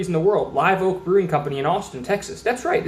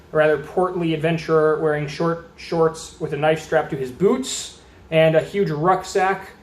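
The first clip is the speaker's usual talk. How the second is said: usual speaking voice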